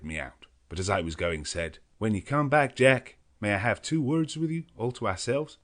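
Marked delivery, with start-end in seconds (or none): 2.0-5.6 higher, louder American voice; Quincey asking a private word; Quincey Morris. A narrator's reading. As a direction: ask him aside, earnestly.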